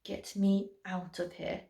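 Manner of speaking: low, soft appalled mutter. A narrator's reading. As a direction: mutter it under the breath.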